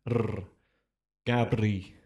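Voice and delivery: Gabri with a trilled rolled R. Shown as a demonstration. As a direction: roll the r hard in Gabri. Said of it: "Do it".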